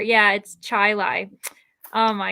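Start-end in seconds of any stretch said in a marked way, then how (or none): 0.3-1.3 Chili said CHY-lye, slowly; slow Rochester CHY-lye; local pronunciation. Shown as a demonstration. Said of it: "'cause in Rochester".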